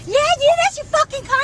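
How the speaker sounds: high, heated mock scream; retold screaming. Retelling the men screaming at her in the store, high mock shout. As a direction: shout it like he's losing it. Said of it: "yelling, screaming at me".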